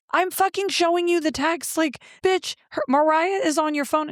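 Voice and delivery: high-pitched, indignant retold protest; exasperated arguing. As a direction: argue it high and incredulous. Retold heated argument with partner.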